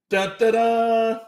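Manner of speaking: slow, high dramatic sting chanted; dramatic dun dun dun. Pretend. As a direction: sing it like a suspense jingle. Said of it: "my dun, dun, dun sound kinda weak".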